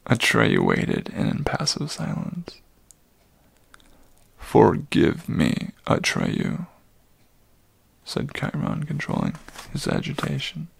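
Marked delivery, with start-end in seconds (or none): 4.4-6.7 put-on slow, low old centaur; apologetic old centaur. Old centaur begs Atreyu's pardon, slow and low.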